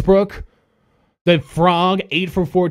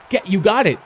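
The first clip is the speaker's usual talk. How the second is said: ordinary delivery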